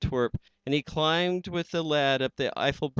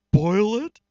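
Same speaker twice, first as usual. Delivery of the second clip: loud, high shocked gasp of a question; 9 semitones higher, 11 dB louder than usual. Acted. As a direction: recoil in horror as you ask it. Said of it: "gasped Horton".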